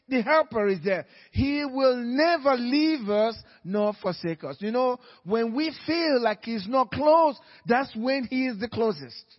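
loud, climbing sermon cry; fired-up preacher hammering the point; preaching at full force. Acted. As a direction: preach it hard to the congregation.

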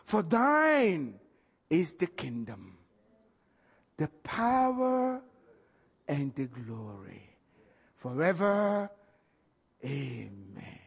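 slow, measured prayer recitation; 1 solemn recitation. Acted. Preacher reciting the prayer's close, slow and solemn. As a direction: intone the doxology with long pauses.

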